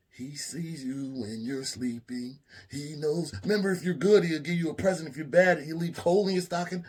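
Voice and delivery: high, rising sing-song warning; Chip reciting Santa. Retold or imitated. Chip explaining coal and Santa, sing-song. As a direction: recite it like a kid's rhyme.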